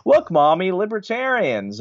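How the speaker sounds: put-on high-pitched excited kid pointing something out to mom; little kid. Little kid tugs mom's arm on the street, high child's voice. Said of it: "this little kid and his mom".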